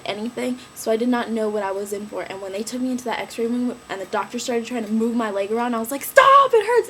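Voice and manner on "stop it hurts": high, loud scream of pain. Retold or imitated. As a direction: scream it as the leg is moved. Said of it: "I was screaming".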